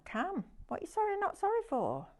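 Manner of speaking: high-pitched, quick teasing question; playful interrogation. Pretend. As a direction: press the viewer in a high mock-puzzled voice.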